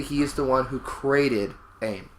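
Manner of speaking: ordinary talk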